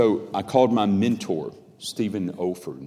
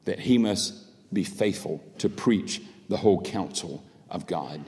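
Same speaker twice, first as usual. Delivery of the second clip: ordinary delivery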